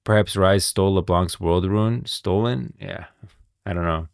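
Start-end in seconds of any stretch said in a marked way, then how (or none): none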